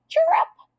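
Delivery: a sharp, squeaky cricket chirrup; high cricket chirp. Acted. Boy hiding in class mimics a cricket, high squeaky chirp. Cricket noise made by Kirk.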